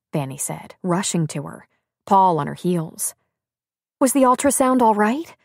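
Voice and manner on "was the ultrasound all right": high, louder anxious question; worried. A narrator's reading. Friend asks crying woman about scan, worried. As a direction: ask it with urgent concern.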